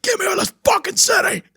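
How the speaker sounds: high, strained comic shout; mock desperate shout. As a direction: yell it like a driver trapped in traffic. Acts out frustration in traffic.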